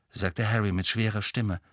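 plain reading voice

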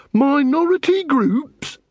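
high, slow, disbelieving echo; incredulous. A narrator's reading. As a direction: Angua repeating Carrot's words in disbelief.

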